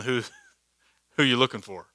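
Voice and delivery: quick, offhand, chuckling through it; casual mock Jesus, laughing. Preacher joking to the congregation, laughing and casual. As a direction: toss it off like a joke, laughing. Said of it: "I thought that was funny".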